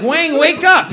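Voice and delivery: loud laughing wake-up call. Retold or imitated. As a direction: shout at a dozing friend. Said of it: "I'm like".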